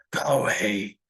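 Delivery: low whispered go away; ominous order. Acted. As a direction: warn him off under your breath. Grandfather orders child away.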